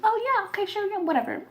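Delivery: high mock-naive agreeing; gullible agreement. Mocking her own gullible agreement.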